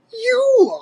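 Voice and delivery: very high incredulous squawk; Cranky, laughing scorn. A narrator's reading. Old ape scoffing at young Diddy, derisive. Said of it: "laughed Cranky".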